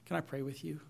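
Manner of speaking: retold tender words to an upset friend; gentle comfort. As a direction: soothe him like an arm round his shoulder. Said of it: "I lovingly put my arm around him and said".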